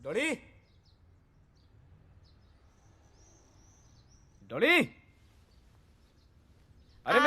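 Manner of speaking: high, rising call to another room; name called out with a rising lilt. Acted. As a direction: call for your wife to fetch something. Calling wife from another room for towel.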